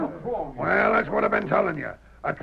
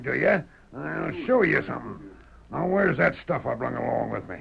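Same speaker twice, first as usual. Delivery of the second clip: ordinary delivery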